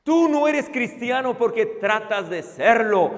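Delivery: ordinary talk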